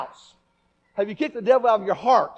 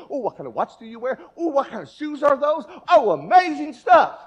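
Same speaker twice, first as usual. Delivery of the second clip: higher, louder fake admiration; mock gushing; 5 semitones higher, 7 dB louder than usual. Preacher mocks worldly people gushing over brands, higher. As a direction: gush over shoes and watches. Mocking shallow worldly talk.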